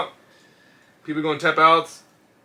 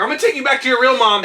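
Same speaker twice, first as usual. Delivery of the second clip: louder, higher teasing threat; mock threatening mom; 8 semitones higher, 6 dB louder than usual. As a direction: retell mom's threat, raised and amused. Mother's threat retold, laughing.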